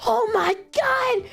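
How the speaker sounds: high, slow gasping mock shock. Viewers reacting to a broken voting habit, high mock shock. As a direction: stretch it out in fake disbelief. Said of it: "people were like".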